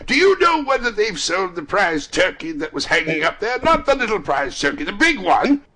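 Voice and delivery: high, loud, eager questioning; excited calling. A narrator's reading. Scrooge asking about the prize turkey, calling eagerly.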